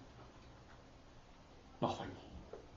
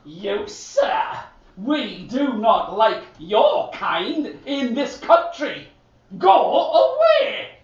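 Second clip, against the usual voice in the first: haughty soldier; 5 semitones higher, slower than usual. Acted. The soldier at the gate sends Niels away, high and snooty. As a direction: look down your nose and dismiss him.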